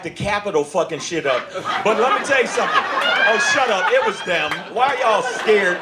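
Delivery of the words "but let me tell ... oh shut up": ordinary talk, nothing marked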